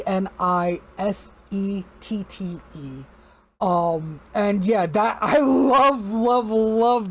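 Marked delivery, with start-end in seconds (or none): 5.2-7.1 drawn-out, higher, emphatic loves; gushing. Real, not acted. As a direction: stretch each love with delight. Gushing praise again.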